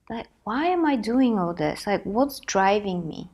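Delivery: usual speaking voice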